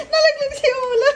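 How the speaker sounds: laughing high while telling the slip. Real, not acted. Owner laughing as puppy slips, high giggling. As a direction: giggle it out, amused.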